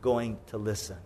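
low, soft exasperated musing; quiet, resigned complaint; weary disbelief. Acted. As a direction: wonder aloud with a shake of the head. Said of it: "God's just shaking his head".

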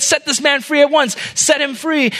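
high-pitched urgent demand; urgent command. Acted. Prince runs up to halt the execution, urgent high command.

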